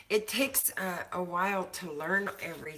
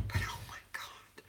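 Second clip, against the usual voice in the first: whisper; 5 dB quieter than usual. Real, not acted. Gushing about the soap.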